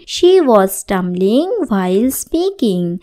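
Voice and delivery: very slow, every word laid out clearly; slow model sentence. Shown as a demonstration. Teacher reading the example sentence to students, very slow. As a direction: say it slowly so learners can follow. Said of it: "teacher models English sentence".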